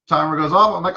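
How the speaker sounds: normal talking voice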